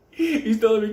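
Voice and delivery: normal talking voice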